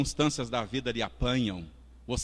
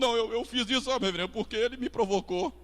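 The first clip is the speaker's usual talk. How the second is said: blame-shifting excuse; 6 semitones higher, faster than usual. Acted. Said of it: "Você põe a culpa no outro".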